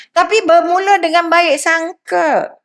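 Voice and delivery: usual speaking voice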